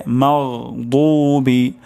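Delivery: slow মাগদুবি with throaty gh and thick d; correct heavy daad. Shown as a demonstration. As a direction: stretch the heavy 'doo' in মাগদুবি. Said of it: "তাহলে উচ্চারণটি হবে". (টেনে, মোটা দদে মাগদুবি)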